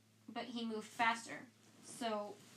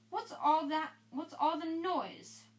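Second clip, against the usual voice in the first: annoyed demand; 6 semitones higher, 4 dB louder, slower than usual. Acted. Percy arriving.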